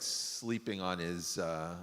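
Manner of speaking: ordinary talk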